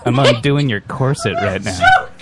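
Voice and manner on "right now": laughing. Acted. Improv dirty talk, laughing.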